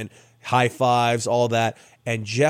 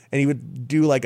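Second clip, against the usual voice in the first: plain reading voice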